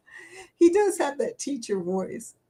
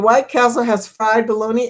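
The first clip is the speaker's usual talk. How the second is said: ordinary talk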